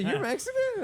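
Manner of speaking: high squeaky mock surprise; surprised question. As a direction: shoot way up in pitch, laughing. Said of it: "He said".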